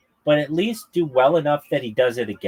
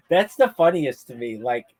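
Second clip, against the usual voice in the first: normal talking voice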